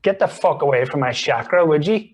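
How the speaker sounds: loud, high hammed-up fury; mock yoga rage. Northern yogi ranting at a neighbour in class, hammed-up loud fury. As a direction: play an outraged yogi telling someone off.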